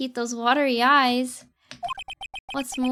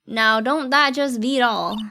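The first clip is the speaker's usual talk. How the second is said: put-on cowboy accent; 5 dB louder, slower than usual. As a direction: play the old cowboy marshal. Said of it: "Awesome accent".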